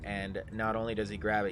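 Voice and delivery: usual speaking voice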